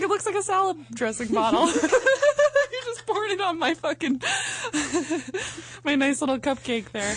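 high-pitched giggling retelling; can barely get the words out for laughing; laughing through it. Real, not acted. Telling the cohost about the lube bottle, giggling high.

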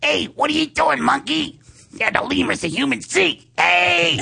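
very high, squeaky buddy voice; monkey voice. Acted. Talking to his friend Munchak in their monkey voice. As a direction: chatter like a cartoon monkey. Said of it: "we talk monkey talk".

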